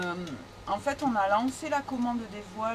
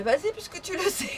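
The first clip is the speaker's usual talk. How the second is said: teasing through laughter, higher and fast; laughing; 12 semitones higher, faster than usual. Real, not acted. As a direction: laugh while handing over the question.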